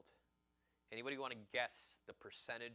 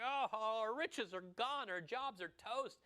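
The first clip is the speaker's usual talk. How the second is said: high, drawn-out wail, louder at first; mock crying; 11 semitones higher, 5 dB louder, slower than usual. Preacher mimicking everybody weeping over lost riches, mock crying. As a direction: wail it slowly in exaggerated despair. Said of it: "Everybody's crying, and they're like".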